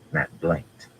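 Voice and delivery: plain reading voice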